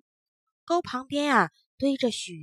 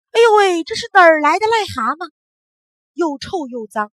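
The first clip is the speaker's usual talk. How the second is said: loud high disgusted shout; grandma cries out; 6 semitones higher, 13 dB louder than usual. Acted. Grandma spots a toad at her feet, loud disgusted cry. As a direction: shriek at the dirty toad. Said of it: "大叫起来".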